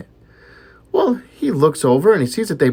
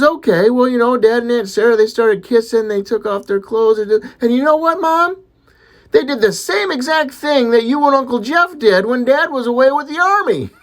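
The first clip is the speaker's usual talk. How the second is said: put-on high, loud little-boy voice; acted kid, high and insistent; eager child tattling; 9 semitones higher, 5 dB louder than usual. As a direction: announce it like an excited small boy. Little boy eagerly finishes his story.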